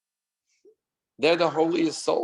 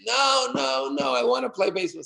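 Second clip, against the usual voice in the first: put-on higher pleading child's no; child whining; 10 semitones higher than usual. Son refusing to go home, whiny child protest. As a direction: insist like a stubborn little boy.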